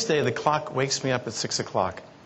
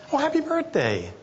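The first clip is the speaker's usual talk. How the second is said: chirpy staff voice; 14 semitones higher than usual. Retold or imitated. Staff member's reply quoted.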